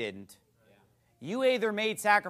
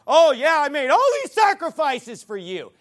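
loud mocking quote of a self-proclaimed martyr; hammed-up loud brag; mock boast; 6 semitones higher, 10 dB louder than usual. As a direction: belt out the bragging sacrifice.

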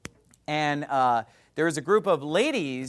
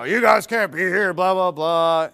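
a lighter, quicker mock yell; retold yell; 6 dB louder than usual. Preacher retelling old guy's order to leave, lighter mock yell. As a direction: toss off the old guy's shout. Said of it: "he's yelling at these ladies".

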